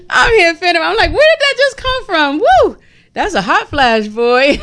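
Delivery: high-pitched, laughing disbelief at a hot flash. Real, not acted. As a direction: crack up while exclaiming, voice shooting up high. Laughing through a sudden hot flash.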